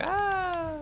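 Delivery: very high squeaky "Ah"; mock Italian sigh. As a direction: play a cornered Italian chef. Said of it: "he's like".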